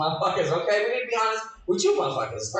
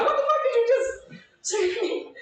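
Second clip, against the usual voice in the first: shrieked much higher and laughing; outraged surprise; 13 semitones higher than usual. Retold or imitated. Comic answering the flirting spoon with a high, laughing outraged shriek. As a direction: shriek it in shocked disbelief. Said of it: "You be like".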